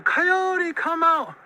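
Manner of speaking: high, drawn-out call; agonized holler. A narrator's reading. Monster hollers to Coyote in agony, high holler.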